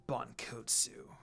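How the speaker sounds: slow, soft, cold naming; glaring name. A narrator's reading. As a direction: spit the name with a glare. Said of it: "Inuyumi glared at him".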